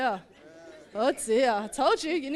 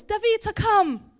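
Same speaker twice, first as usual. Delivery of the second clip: higher, louder parental summons; calling out; 6 semitones higher, 5 dB louder than usual. Acted. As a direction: call the child in from the field. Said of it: "his mum and dad called".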